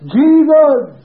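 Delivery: very high, loud cry; full-force shout for the whole ward to hear; retold shout. Preacher crying out over a sick man, high shout. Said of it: "I suppose the whole ward heard".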